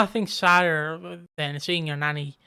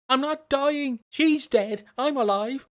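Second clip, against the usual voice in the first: higher, louder indignant protest; grieving grandson shouting at his clingy cousin; exasperated yell; 7 semitones higher, 4 dB louder than usual. Retold or imitated. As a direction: set him straight in frustration. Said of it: "I'm like".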